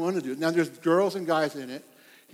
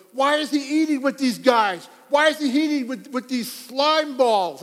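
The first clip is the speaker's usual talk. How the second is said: higher, louder mock outrage; scandalized Pharisees; 6 semitones higher, 5 dB louder than usual. Preacher voicing Pharisees appalled at Jesus, mock outrage, higher and louder. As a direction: play the shocked Pharisees, voice pushed up. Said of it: "their disciples and goes".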